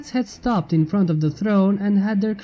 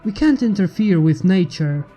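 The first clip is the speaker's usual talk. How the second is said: ordinary delivery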